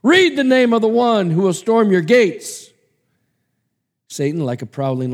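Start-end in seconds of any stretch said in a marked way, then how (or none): 0.0-2.7 angel's command, louder and higher. Acted. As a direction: order him to read.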